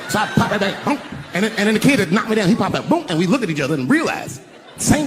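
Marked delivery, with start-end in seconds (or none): none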